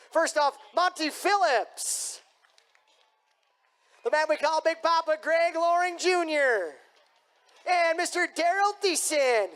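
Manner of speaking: high, drawn-out name announcements; arena announcer introduction. Real, not acted. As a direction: stretch each name out for the crowd.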